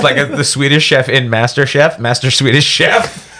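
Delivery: usual speaking voice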